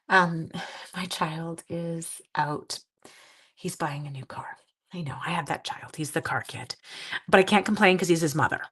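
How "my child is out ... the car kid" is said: hushed aside. Real, not acted. Confiding aside to viewers.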